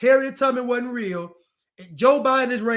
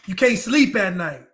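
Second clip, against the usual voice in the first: usual speaking voice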